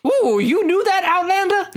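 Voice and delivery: very high, teasing villager voice; suspicious local. Acted. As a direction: squeak it out like a wary villager. Mocking stranger in local voice.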